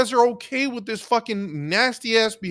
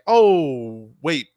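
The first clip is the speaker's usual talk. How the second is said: mock realization with a loud, drawn-out oh; sarcastic; 6 semitones lower, 4 dB louder than usual. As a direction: draw out a sarcastic falling "oh". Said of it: "you got me being sarcastic".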